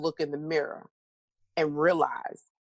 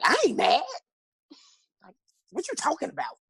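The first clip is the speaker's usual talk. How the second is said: defensive denial; 11 semitones higher, faster than usual. Retold or imitated. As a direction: brush off the accusation. Retelling her denial to her dad.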